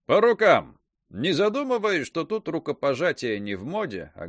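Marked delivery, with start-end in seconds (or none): 0.0-0.8 loud, excited shout; eager agreement. A narrator's reading. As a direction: seal the deal with gusto. Hero accepts eagerly.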